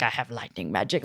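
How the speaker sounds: low, hushed mock-brooding voice; classic edgelord. Girl announcing her affinity, classic edgelord. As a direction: drop it low and quiet like a parody edgelord. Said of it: "a classic edgelord thing".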